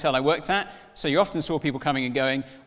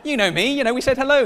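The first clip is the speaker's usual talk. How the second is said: high, insistent, eager pleading; desperate pleading; 8 semitones higher, 3 dB louder than usual. Retold or imitated. Fan pleading with celebrity in queue, high and frantic. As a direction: convince him you've met, high and frantic.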